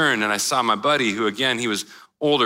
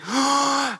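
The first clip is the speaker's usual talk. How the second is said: high sharp gasp coming up from underwater; sharp gasp for air; 12 semitones higher than usual. Retold or imitated. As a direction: gulp air like surfacing from a wave. Surfacing between waves in story.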